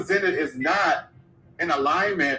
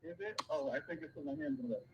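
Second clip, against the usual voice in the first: underwater; 17 dB quieter, faster than usual. Real, not acted. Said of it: "you sound like you're underwater".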